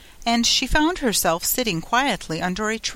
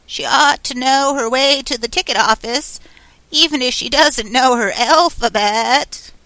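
loud, high-pitched proclamation; loud goat voice; 6 semitones higher, 8 dB louder than usual. A narrator's reading. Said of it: "said in a loud voice".

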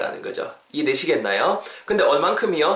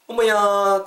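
높고 길게 끄는 감탄; 연기한 놀람; 7 semitones higher, 4 dB louder, much slower than usual. Acted. 국을 간 보는 상황극. (놀란 척하는 감탄조)

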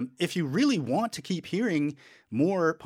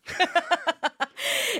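high burst of laughter. Real, not acted. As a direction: break into a squealing laugh. Laughing at own joke.

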